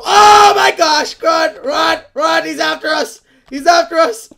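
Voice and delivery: high, loud panicked yelling; panicked shout. Real, not acted. Gamer fleeing a creeper, yelling at teammates in panic. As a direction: scream for everyone to run.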